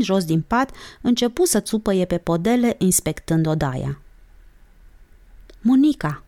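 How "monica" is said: high, mildly shouted name; sudden exclamation. A narrator's reading. Olguța suddenly calling her friend, excited cry. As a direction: call out as if discovering a continent. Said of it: "țipă ea deodată, cu intonația prezumată a lui Cristofor Columb".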